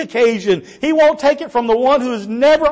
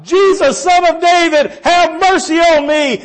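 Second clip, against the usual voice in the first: high, loud desperate plea; yelling out; 7 semitones higher, 4 dB louder than usual. Acted. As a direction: cry out to be heard over the crowd. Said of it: "he starts yelling out, he starts crying out".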